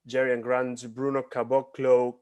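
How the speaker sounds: usual speaking voice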